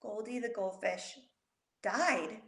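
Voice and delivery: quiet mournful reply; hushed sad. Retold or imitated. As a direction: break the sad news softly. Sad reply about pet.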